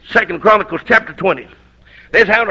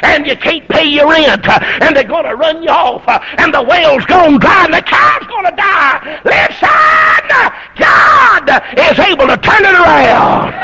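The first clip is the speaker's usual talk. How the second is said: preaching at full force; 4 semitones higher than usual. Acted. Preacher shouting hope at the congregation over trouble, full-force preaching. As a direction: pile up the troubles and shout God's answer.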